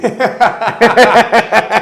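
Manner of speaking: loud high burst of laughter; big laugh. Real, not acted. Laughing hard at his own story, loud burst.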